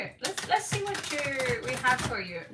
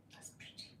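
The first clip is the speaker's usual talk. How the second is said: whisper; 20 dB quieter, faster than usual. Real, not acted. Whispered aside.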